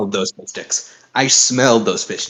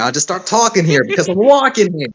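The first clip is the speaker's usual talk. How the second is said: put-on Brooklyn accent; 7 semitones higher, 3 dB louder than usual. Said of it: "I can't get away from the Brooklyn accent".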